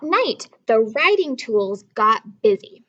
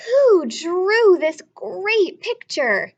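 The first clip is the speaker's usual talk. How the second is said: higher, louder, slow amazement; amazed; 6 semitones higher, 6 dB louder, slower than usual. A narrator's reading. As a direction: ask in wonder. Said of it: "She couldn't believe her eyes".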